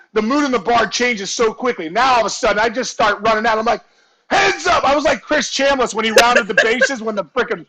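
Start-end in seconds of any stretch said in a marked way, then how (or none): 4.3-4.8 high-pitched retold warning shout; mock holler to clear the way; warning call. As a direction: call out while barging through. Warning as he runs out.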